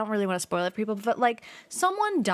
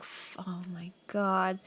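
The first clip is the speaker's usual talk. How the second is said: slow, breathy imitated whisper under her breath; mock whisper; 3 semitones lower, 7 dB quieter, slower than usual. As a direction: whisper it slowly, as if ruining the moment. Reacting to sad movie scene.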